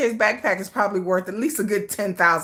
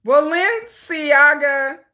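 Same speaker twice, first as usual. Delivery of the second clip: "Balenciaga" drawn out, higher; slow sounding-out; 4 semitones higher, 3 dB louder, much slower than usual. Real, not acted. Typing a search to be nosy, "Balenciaga" slowly sounded out. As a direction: stretch the syllables of "Balenciaga".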